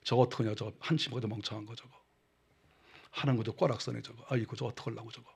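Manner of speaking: low, soft muttered scorn; contemptuous sneer. Retold or imitated. Preacher voices himself sneering at someone, contemptuous. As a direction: sneer quietly at a fool. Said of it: "나는 비웃는 게 많아".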